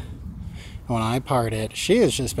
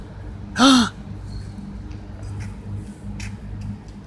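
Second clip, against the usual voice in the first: gasp; 10 semitones higher, 7 dB quieter than usual. Real, not acted. Watching a wild disc throw.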